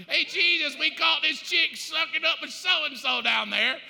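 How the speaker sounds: high, loud hail; shouting accuser. Acted. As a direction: bellow the accusation across the crowd. Pharisees calling out, retold.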